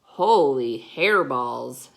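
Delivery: loud, drawn-out exclamation; storybook outburst, stretched and booming; exclaimed. A narrator's reading. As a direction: exclaim it big and slow. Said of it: "exclaimed Skippy John Jones".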